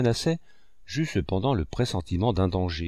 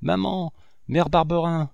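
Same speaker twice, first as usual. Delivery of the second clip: put-on a child calling high across the fields; desperate call for mother, pitched high; 7 semitones higher than usual. A narrator's reading. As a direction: call out for her, voice lifted high. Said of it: "Je me mis à appeler".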